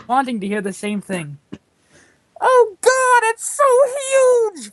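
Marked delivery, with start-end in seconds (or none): 2.4-4.7 high, drawn-out slow screech; mock ecstatic screech, much higher and louder. A narrator's reading. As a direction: shriek it long and high in a put-on voice. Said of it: "Fallon screeched".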